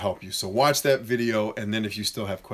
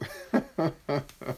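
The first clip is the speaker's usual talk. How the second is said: real amused chuckle; laugh; 4 semitones higher, 4 dB quieter than usual. Real, not acted. Laughing at own mock morning greeting, amused chuckle. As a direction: burst of soft chuckling.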